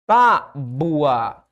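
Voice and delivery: "tábua" broken into TÁ, pause, bua; syllable split. Shown as a demonstration. Teacher showing the separation, "tábua" split with loud TÁ. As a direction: punch the first syllable of "tábua", then a drawn-out bua. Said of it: "separação da palavra tábua".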